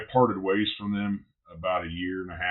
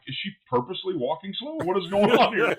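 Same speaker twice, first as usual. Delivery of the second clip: high-pitched retold disbelief, louder at the end; incredulous; 10 semitones higher, 4 dB louder than usual. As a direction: act out baffled exasperation, building. Retelling watching her walk slowly, baffled.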